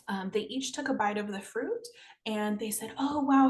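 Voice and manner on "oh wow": loud, delighted gasp of wonder; excited wow. Acted. Children tasting the sweet fruit, loud and excited. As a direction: burst out amazed at the taste.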